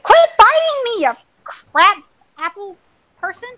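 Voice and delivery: sharp high scold at a biting cat. Real, not acted. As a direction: tell off the kitty, high and loud.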